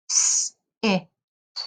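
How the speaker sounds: sounds split apart, one by one, like a robot; robot talk. Shown as a demonstration. Teacher demonstrating blending to students, robot voice. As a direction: segment each sound like a robot. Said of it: "when the teacher talks like a robot".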